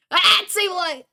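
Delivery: high startled cry; startled yelp. Real, not acted. Gamer suddenly sprinted at in the game, startled yelp. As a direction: yelp in disbelief.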